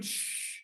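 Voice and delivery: soft, voiceless hiss of shh; cinemagoers' shush. Retold or imitated. People behind hushing dad, imitated shush. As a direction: shush like an annoyed audience. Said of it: "the people behind are going".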